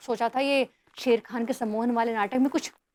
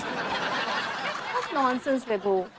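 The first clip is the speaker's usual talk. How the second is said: playful drawl; much slower than usual. Acted. Playful dismissal to husband.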